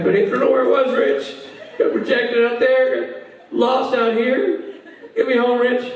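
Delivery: high, slow plaintive drone; drunk Rob. Retold or imitated. Drunk friend begging Rich to get him home, high slow plaint. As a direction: draw it out like a wronged drunk. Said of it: "He pulls up".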